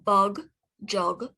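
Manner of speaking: ordinary delivery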